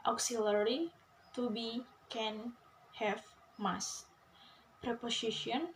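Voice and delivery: ordinary delivery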